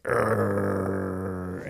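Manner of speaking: drawn-out mock growl; imitated dog growl. Owner imitating Gus after a hug, growling. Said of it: "Gus growls at us".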